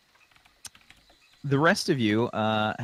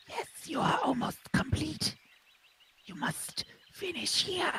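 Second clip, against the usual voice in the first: a tiny drake whispering instructions; whispery dragon voice; 7 dB quieter than usual. Acted. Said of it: "he says to you".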